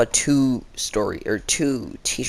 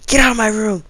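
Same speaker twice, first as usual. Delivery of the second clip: teacher's angry shout, raised and loud; retold yell; 11 semitones higher, 7 dB louder than usual. Teacher ordering a kid out, loud angry shout.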